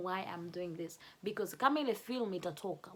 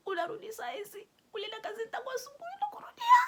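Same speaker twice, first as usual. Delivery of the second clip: high, tearful, fast accusation; crying; 13 semitones higher, 5 dB louder than usual. Acted. Wife scolding husband coming home late, crying and high. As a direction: rush it out through tears. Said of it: "I was crying".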